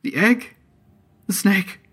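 very high, loud babble with rising swoops; deranged nonsense in separate little bursts; speaking up. A narrator's reading. The broken-nosed man answers Artemis with nonsense, speaking up. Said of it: "The broken-nosed man spoke up".

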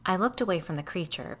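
usual speaking voice

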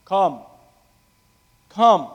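yearning call. Retold or imitated. Preacher echoing Armando's longing call to come. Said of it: "I like the way Armando said it".

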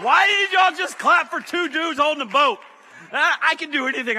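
high loud playful shout; mock outrage at the clapping crowd. Real, not acted. Mock scolding the audience.

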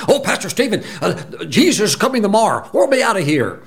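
high, giddy mock believer with a laugh; mock eager believer. Pastor mocking believers who expect Jesus tomorrow, giddy high voice. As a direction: poke fun at the rapture crowd, way up high. Mimics people rushing the rapture.

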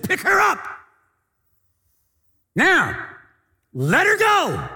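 high, loud barked order; a stern healer's command, full voice; commanding shout. Retold or imitated. Wigglesworth to the two ladies, a loud commanding shout.

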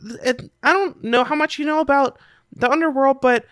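put-on high-pitched, hesitant kid voice; kid character. Game master voices a kid.

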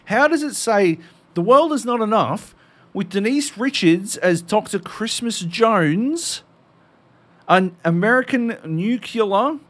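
mocking disbelief. Mocking the plot summary.

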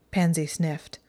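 ordinary delivery

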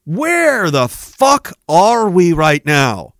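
very slow, drawn-out, emphatic question; incredulous exasperation. Real, not acted. Speaker appalled at the state of the country, slow exasperation. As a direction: stretch each word out in appalled disbelief.

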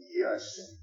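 high, breathy mock of the Pharisees' jab; mocking accuser. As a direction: squeak it out like a petty accuser. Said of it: "That's their argument".

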